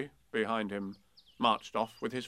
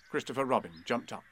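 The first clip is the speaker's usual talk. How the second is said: ordinary delivery, nothing marked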